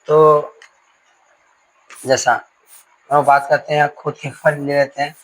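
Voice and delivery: normal talking voice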